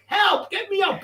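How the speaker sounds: high, yelled cry for help from the cell; mock panicked yell. Guys in the cell yelling for the guards, high mock panic. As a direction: call out like you're trapped behind a locked door. Cellmates banging on the door.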